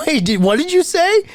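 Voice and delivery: high-pitched imitated double take; mock-incredulous, pitched well up; retold disbelief. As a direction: act out a startled interruption. Retold reaction to an outrageous nickname.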